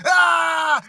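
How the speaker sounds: high, loud mock roar; retold roar. Jimmy roaring at the two guys in the sauna, a loud mock roar. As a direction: bellow it like a charging bull. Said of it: "and he goes".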